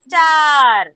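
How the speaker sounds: "Star" called out loud and drawn out; word demonstration. Shown as a demonstration. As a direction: stretch "Star" long and loud so children hear its sounds. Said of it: "now I'm going to tell you the name".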